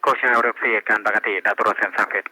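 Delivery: radio transmission voice; over walkie talkie. Real, not acted. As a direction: speak through a handheld police radio. Field unit reports over radio. (เสียงผ่านวิทยุสื่อสาร)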